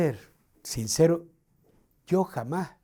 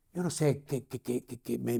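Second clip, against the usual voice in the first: usual speaking voice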